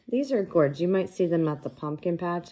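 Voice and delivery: ordinary talk, nothing marked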